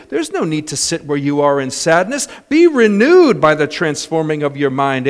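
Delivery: loud, high-pitched urging; retold encouragement, pushed high and forceful; rousing exhortation. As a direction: build a pep talk into full preaching. Retold pep talk builds into exhortation.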